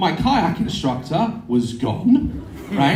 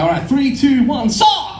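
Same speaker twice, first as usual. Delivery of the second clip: high countdown bursting into a much higher final cry; shouted countdown; 9 semitones higher than usual. Retold or imitated. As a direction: count them off and launch the last word. Instructor's countdown launch, acted.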